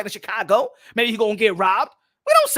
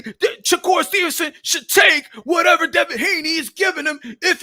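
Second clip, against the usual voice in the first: loud, higher ranting fan; mock fan rant; 6 semitones higher, 4 dB louder than usual. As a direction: shout the fan's demand. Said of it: "Some of y'all fans will be like".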